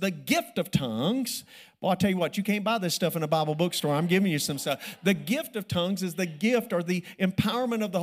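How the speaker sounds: loud, driving sermon delivery; fervent preaching. Acted. Preacher explaining the gift of tongues to his church, loud and fervent. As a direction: hammer the teaching home. Preacher teaching at full force.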